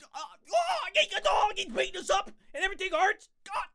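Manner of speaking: high, whimpering panic; mock pain cries. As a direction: cry out like you're being beaten. Being beaten up, mock pain.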